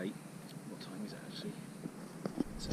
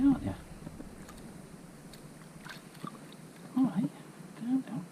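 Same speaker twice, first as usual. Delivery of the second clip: excited. Real, not acted. Handling a wriggling fish.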